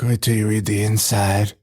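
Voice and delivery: low put-on voice; imitated movie character. Retelling Ed's line to co-host, mock low character voice. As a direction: drop into a low voice to mimic Ed.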